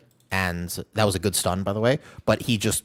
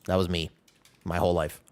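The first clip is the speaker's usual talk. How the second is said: ordinary talk, nothing marked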